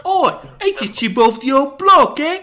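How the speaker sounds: very loud, high-pitched Cockney shout; Cockney accent. Shown as a demonstration. Host showing off a good Cockney accent, shouted and high. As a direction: holler it in exaggerated Cockney. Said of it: "I can do a good Cockney accent".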